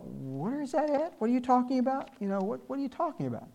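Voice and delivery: mock bewilderment. Mocking a confused churchgoer.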